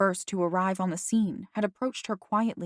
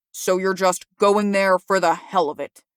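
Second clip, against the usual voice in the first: loud, disgusted exasperation; disgusted annoyance; 7 dB louder than usual. A narrator's reading. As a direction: spit it out in disgust. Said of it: "he exclaimed in disgusted annoyance".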